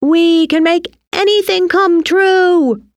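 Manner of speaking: high, exultant held-out shout. A narrator's reading. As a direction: proclaim it high to the whole sky. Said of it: "they shouted together".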